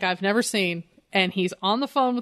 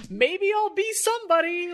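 high, put-on airy voice; hammed-up clueless dabbler; mock indecisive candidate; 10 semitones higher than usual. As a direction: sing it out vaguely, mocking him. Mocks Bruce's wishy-washy political indecision.

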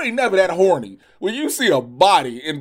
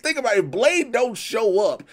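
ordinary talk

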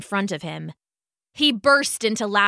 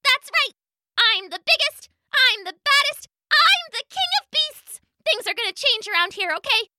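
very high, boastful bug voice; bossy gnat voice; 12 semitones higher than usual. Acted. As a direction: strut like a tiny tyrant. Gnat bossing animals.

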